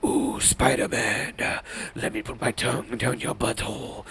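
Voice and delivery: creepy monster come-on, dropped well below your voice; hammed-up seductive creature voice; Venom. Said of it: "He could be like".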